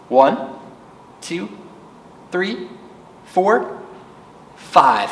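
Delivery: cheerful counting for little ones; counting along. Shown as a demonstration. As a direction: count slowly with the children. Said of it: "Can you count with me?".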